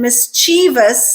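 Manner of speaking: mischievous as mis-CHEE-vus; long E variant. Shown as a demonstration. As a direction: stress CHEE in mischievous, three syllables. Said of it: "we pronounce that second syllable as E".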